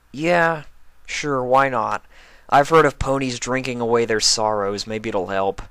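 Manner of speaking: weak smile. A narrator's reading. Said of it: "smiled weakly".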